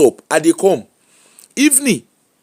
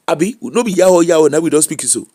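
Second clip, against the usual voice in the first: ordinary talk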